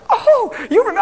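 high, loud squeal of surprise; shrieked happy disbelief; delighted amazement. Retold or imitated. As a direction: imitate someone thrilled to be remembered. Voices delighted woman being remembered.

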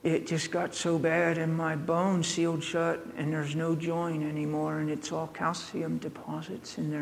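lightly voiced girl, quiet and soft. Girl explaining her jaw to preacher, softly. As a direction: tell it gently as the hurt girl. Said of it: "She says".

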